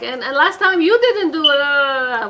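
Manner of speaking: acted defensive comeback, loud and high; retort. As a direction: fire back in a mock spousal argument. Acted wife answering husband back.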